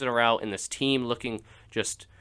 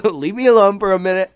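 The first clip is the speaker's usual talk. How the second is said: laughing; 6 semitones higher, 8 dB louder than usual. Real, not acted. Laughing after the amazing thing.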